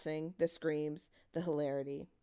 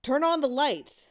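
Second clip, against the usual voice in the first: firm call; 8 semitones higher, 7 dB louder than usual. A narrator's reading. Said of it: "said one of the adults".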